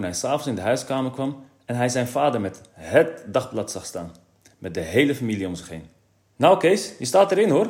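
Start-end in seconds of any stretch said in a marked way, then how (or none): 6.4-7.7 much higher, louder proud father; a boisterous father calling his son. A narrator's reading. Father calling to Kees about the newspaper, loud and proud. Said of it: "riep de heer Brummer luidruchtig".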